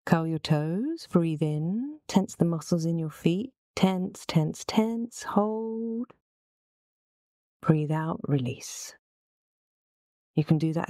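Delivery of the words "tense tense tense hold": building tension. Real, not acted. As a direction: pitch climbing with each word. Coaching muscle tensing before release.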